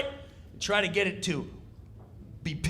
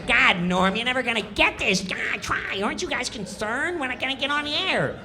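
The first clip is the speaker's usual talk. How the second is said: higher, fast whiny needling; Kattan nagging; 8 semitones higher than usual. Retold or imitated. Comic mimics Kattan pestering Norm, high and whiny. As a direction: badger him quickly.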